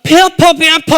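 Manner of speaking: high, loud mock wail of pain; imitating a son screaming in pain; retold child's cry. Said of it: "갑자기 막 소리를 지르는 거예요".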